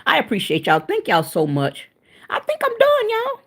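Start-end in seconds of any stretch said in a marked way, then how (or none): 2.3-3.4 playful squeal. Real, not acted.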